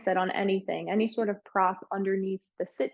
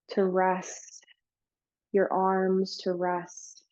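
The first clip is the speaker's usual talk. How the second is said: guided meditation. Real, not acted. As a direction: ease them gently through it, leaving space between phrases.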